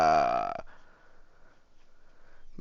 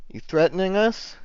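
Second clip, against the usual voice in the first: high-pitched challenge, rising question; wary challenge; 10 semitones higher than usual. Acted. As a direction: answer a threat with a raised-pitch challenge. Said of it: "puts his hand on his sword".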